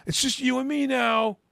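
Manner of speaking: high, loud taunting call; retold calling out. Acting out David calling to the man in the bathroom, loud calls. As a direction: call it out to someone behind a door. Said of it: "Yeah, he goes".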